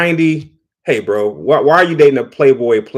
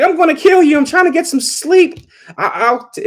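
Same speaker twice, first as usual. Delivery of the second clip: high, mock-annoyed sleepy wife; imitating a wife pretending to be mad; jokingly mad. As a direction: playfully scold him for waking her. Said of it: "jokingly mad".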